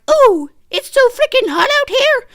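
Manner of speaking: very high, loud whiny imitation; hammed-up high-pitched moaning; mock complaining. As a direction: mimic a whiner complaining about the heat. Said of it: "Peoples were complaining like".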